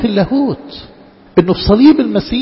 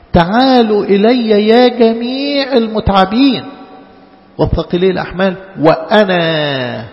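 solemn proclamation; 3 semitones higher, 3 dB louder than usual. Acted. Quoting Jesus's invitation.

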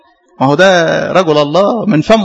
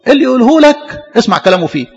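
a hint of a brisk rebuke, higher; lightly voiced Christ; 6 semitones higher than usual. Preacher voicing Christ to congregation, light brisk rebuke. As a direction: brush off the worry, higher pitch. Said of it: "قال لك".

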